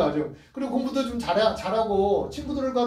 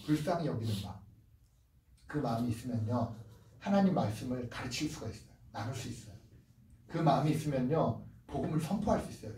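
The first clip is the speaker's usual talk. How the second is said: intimate hush; 6 semitones lower, 7 dB quieter than usual. Real, not acted. Sermon dropping to intimate hush.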